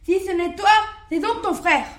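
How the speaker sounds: wolf justifying his revenge, furious; raging wolf. Acted. Wolf rages, decides revenge.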